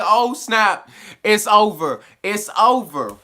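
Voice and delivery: loud, high shocked "oh snap"; alarmed exclamation. Real, not acted. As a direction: react like the bad guys just burst in. Narrator reacts to goons barging in.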